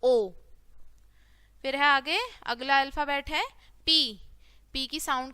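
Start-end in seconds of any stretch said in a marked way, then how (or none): 0.0-0.3 ओ as an isolated "oh" vowel; letter sound demo. Shown as a demonstration. Teacher showing the sound in owl, letter sound demo. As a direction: sound out ओ on its own. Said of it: "क्या बोलेंगे".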